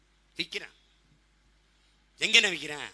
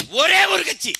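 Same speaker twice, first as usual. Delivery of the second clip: very high, loud burst; emphatic shout; 12 semitones higher, 10 dB louder, slower than usual. Real, not acted. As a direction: proclaim it at the top of the voice. Proud claim for his party. (உரக்கக் கத்தி முழக்கம்)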